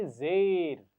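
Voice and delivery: ज़ेर said clearly and loudly for repeating; ज़ेर held out firmly; model word. Shown as a demonstration. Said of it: "सब इसका नाम बोलिए".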